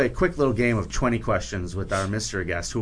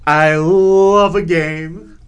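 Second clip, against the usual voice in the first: hammy excitement; 5 semitones higher, 10 dB louder, much slower than usual. Acted. Hammed-up game show line.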